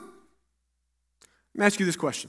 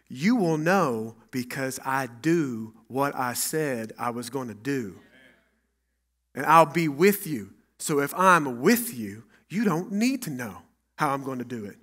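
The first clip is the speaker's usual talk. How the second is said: God's calm reply; 8 semitones lower, 11 dB quieter than usual. Retold or imitated. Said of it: "God says".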